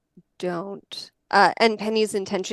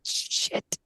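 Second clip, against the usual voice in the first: whispered curse; 9 dB quieter than usual. Retold or imitated. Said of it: "she's like".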